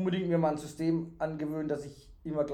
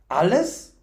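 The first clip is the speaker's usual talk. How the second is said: high, loud, rising question; thrilled fool asking for confirmation; delighted cry; 7 semitones higher, 8 dB louder than usual. A narrator's reading. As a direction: burst out in happy disbelief. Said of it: "rief der Narr erfreut".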